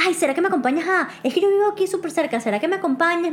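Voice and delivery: rapid-fire imitation of the date; very fast. Date asking to be walked home, very fast. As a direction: rattle off the request in one rush. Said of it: "pero muy rápido".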